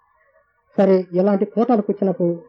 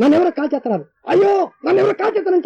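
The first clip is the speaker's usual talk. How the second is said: panicked cry; 9 semitones higher than usual. Retold or imitated. Retells crying out while burning.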